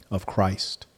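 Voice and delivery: ordinary delivery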